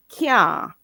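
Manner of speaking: "can't" said as a long "kyah"; Patois pronunciation of can't. Shown as a demonstration. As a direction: stretch "can't" into "kyaa", no final t. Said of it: "I'm gonna repeat myself".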